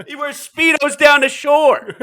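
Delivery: high, loud, cracking up; laughing. Real, not acted. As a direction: shout the punchline through laughter. Cracks himself up mocking a fan.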